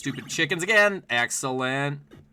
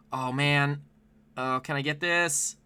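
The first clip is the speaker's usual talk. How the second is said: normal talking voice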